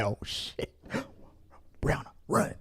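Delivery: hushed, breathy panic to a friend; panicked kid whisper. Retold or imitated. Kid warning his friend to run home, whispered panic. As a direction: whisper the alarm to your buddy.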